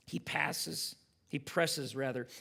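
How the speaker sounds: ordinary talk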